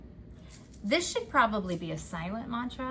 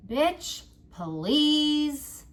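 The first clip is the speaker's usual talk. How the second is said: bitch, please said much higher and louder, stretched out; emphatic dismissal; 7 semitones higher, 7 dB louder than usual. Shown as a demonstration. Reader urging viewers to silence the inner jerk, loud bitch, please. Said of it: "say as many".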